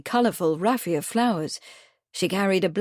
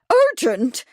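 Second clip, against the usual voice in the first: high, loud indignant outburst; very loud cry; 9 semitones higher, 5 dB louder than usual. A narrator's reading. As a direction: fling the word back, scandalised. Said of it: "cried Miss Truebody in a very loud voice".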